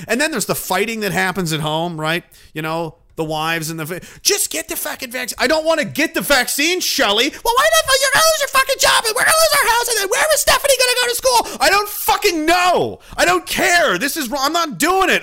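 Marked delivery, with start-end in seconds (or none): 4.2-5.4 mock nagging wife. Acted couple fight, the wife. 5.4-7.3 higher, louder mock protest; mock angry husband. Acted couple fight, the husband. 7.3-11.6 very high, loud screeching wife voice; wife screaming about the job and the house; shrieking wife. Retold or imitated. Acted couple fight, the wife. 11.6-15.2 higher, louder mock yell; husband yelling back at his wife; angry husband shouting. Acted couple fight, the husband.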